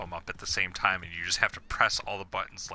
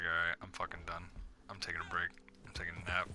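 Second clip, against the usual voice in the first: fed-up grumble; 2 semitones lower, 7 dB quieter, much faster than usual. Retold or imitated. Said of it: "got so frustrated that I was just like".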